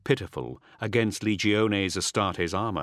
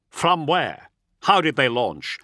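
louder, higher urgent questions; raised officer's interrogation; demanding; 5 semitones higher, 5 dB louder than usual. A narrator's reading. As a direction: press your subordinate for answers. Captain demands answers from subordinate.

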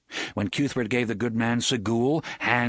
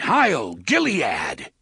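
battle cry; 5 dB louder, much slower than usual. Acted. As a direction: charge cry to the riders. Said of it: "he cried".

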